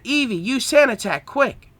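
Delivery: high-pitched, hurried-sounding order; urgent command. A narrator's reading. Trainer ordering Eevee to put out the fire, urgent high command. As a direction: call out the attack as an emergency.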